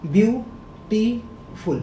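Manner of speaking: ब्यूटीफुल broken into three slow beats, ब्यू, टी, फुल; teacher counting the three syllables of ब्यूटीफुल. Shown as a demonstration. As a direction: sound out ब्यूटीफुल slowly with a pause after each syllable. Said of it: "इसके कितने syllable हो गए".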